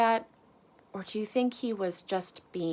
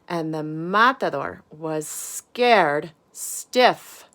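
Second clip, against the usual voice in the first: lively storybook voice, big swings up and down; animated read-aloud for a class; reading to children; 10 dB louder than usual. A narrator's reading. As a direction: read it out like bedtime story time for little ones. Reads picture book to children.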